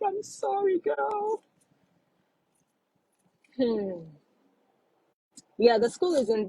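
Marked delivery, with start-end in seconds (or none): 0.1-1.4 high sympathetic voice. Retold or imitated. Comforting the sad viewer.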